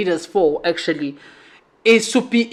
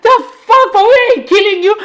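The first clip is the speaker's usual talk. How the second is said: much higher, laughing disbelief; incredulous retelling; 10 semitones higher, 3 dB louder than usual. Retold or imitated. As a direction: squeal the protest in amused shock. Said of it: "I'm like".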